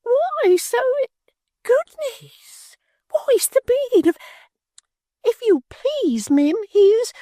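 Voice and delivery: very high, slow, tearful fake fright; a martyr bidding farewell, squeaking; Miggs, faint and fearful. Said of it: "cried Miggs".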